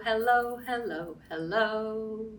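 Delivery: ordinary talk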